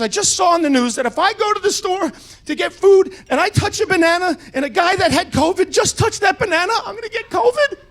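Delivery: panicky daughter. Retold or imitated. Daughter panicking to her dad about a banana, high whiny panic. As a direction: play the freaked-out millennial daughter close to tears. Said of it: "She goes".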